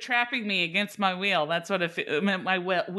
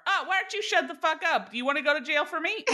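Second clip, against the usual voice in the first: higher, hammed-up snapping rant; mock furious retort; 9 semitones higher than usual. Snapping back at the mother at the party, mock fury. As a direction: lash out in mock outrage.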